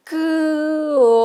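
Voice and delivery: comida slow and very drawn out, every vowel held long. Shown as a demonstration. Teacher stretching the word further for children, very slow. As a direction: pull comida out as long as you can. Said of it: "La estiramos más todavía".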